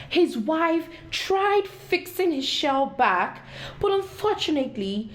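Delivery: ordinary delivery, nothing marked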